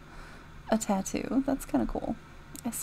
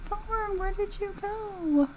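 high calling voice; 10 semitones higher, slower than usual. Real, not acted. Calling for a lost sim.

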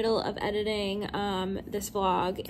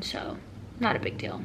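ordinary talk

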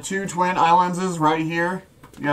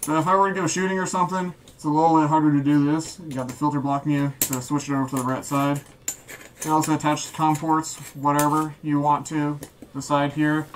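muffled voice inside a gas mask; through a gas mask; 3 semitones higher than usual. Real, not acted. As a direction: talk with the mask sealed over your face. Demo talking while wearing mask.

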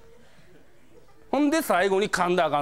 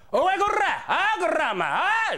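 yankee yelling; 13 semitones higher, slower than usual. Retold or imitated. Delinquent yelling at a staffer at the used-book counter, furious. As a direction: bark it like an enraged delinquent. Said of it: "めっちゃキレてはるんです".

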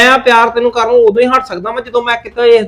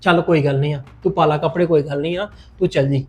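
soothing; 6 semitones lower, 6 dB quieter than usual. Acted. Husband calms angry wife.

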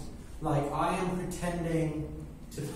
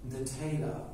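ordinary talk

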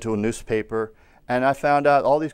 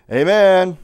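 loud, higher "Amen" call; 6 semitones higher, 10 dB louder than usual. Real, not acted. Preacher pressing congregation to answer amen, calling out. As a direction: prompt the crowd with a raised, louder voice.

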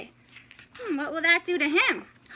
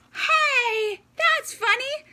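mock surprise; 8 semitones higher, slower than usual. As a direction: act amazed the lights went off. Lights went out in book.

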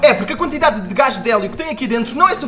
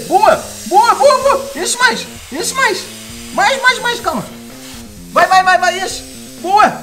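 higher, louder excited cheering; 9 semitones higher, 3 dB louder, slower than usual. Real, not acted. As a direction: cheer it on eagerly. Excited while ball inflates.

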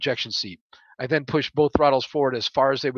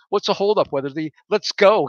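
getting louder and higher each word; voice rising; 6 semitones higher, 7 dB louder than usual. Acted. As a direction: build impatience word by word. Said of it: "his voice raising with each word".

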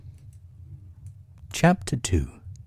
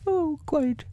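very high, assured bear voice; Pooh; slower than usual. Acted. Bear assuring the rabbit of himself, very high voice. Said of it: "Are you sure?".

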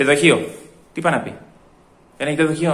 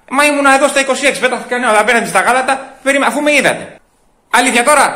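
higher, heated queue complaint; indignant protest; 7 semitones higher, 3 dB louder than usual. Acted. Customer to counter staff over a missed turn, indignant. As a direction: protest loudly that you were skipped.

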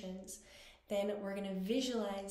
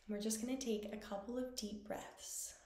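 guided meditation; 5 dB quieter than usual. Acted. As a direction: guide them gently through each breath, unhurried and quiet.

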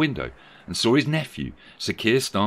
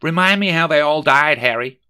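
louder, higher-pitched question to a companion; lightly voiced Megan; 8 semitones higher, 8 dB louder than usual. Megan asking Harry about the deaths, lightly dramatised. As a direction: put some acted volume into the question. Acts Megan in story dialogue.

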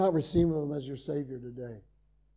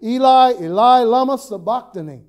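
loud cry; 5 semitones higher, 11 dB louder than usual. Acted. Preacher voicing Jesus crying out from the cross, loud. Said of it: "Jesus cried with a loud voice".

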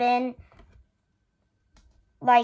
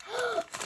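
gasp; 10 semitones higher, 12 dB quieter than usual. Real, not acted. Reacting to unboxing surprise.

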